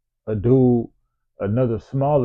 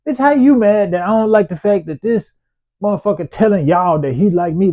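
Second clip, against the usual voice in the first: retold angry outburst; 8 semitones higher, 5 dB louder than usual. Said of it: "I'm just like".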